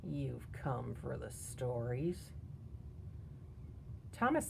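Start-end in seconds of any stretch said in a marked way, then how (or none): 0.0-2.3 put-on slow, quiet old man's sneer. A narrator's reading. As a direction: draw it out softly with disdain. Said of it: "said with a sneer".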